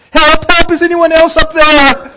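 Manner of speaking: high, loud desperate yell; yelling for help. Acted. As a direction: shout it up the cliff, looking for anyone else. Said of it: "Jack yelled".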